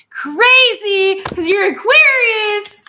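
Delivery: high, loud, slow exasperation; exasperated outburst. Real, not acted. Reader exasperated at Aquarius viewers, high and loud. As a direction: let it burst out in disbelief.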